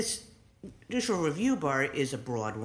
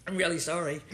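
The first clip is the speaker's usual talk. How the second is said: laughing apology; 3 semitones higher, faster than usual. Real, not acted. Laughs after coughing fit.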